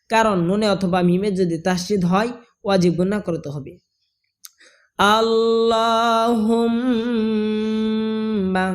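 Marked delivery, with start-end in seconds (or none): 5.2-8.7 higher, slow chant of আল্লাহুম্মা with long m; held ghunnah. Shown as a demonstration. Teacher repeating for learners, আল্লাহুম্মা chanted with long ghunnah. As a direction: hum through the doubled m of আল্লাহুম্মা.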